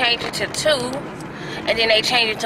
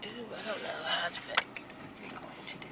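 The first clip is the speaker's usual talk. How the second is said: soft whisper with a laugh; 5 semitones lower, 20 dB quieter than usual. Real, not acted. To viewers while parking, whispering with a laugh. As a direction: murmur it under her breath, unsure. Hushed aside in the driveway.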